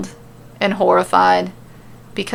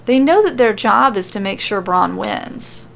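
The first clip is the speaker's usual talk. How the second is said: plain reading voice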